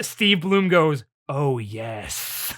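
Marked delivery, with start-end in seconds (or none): 1.3-2.5 low, soft, weirdly sultry purr. Retold or imitated. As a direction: drop it low and seductive, played for laughs. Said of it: "in a weirdly sultry voice".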